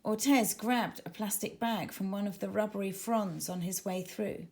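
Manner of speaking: usual speaking voice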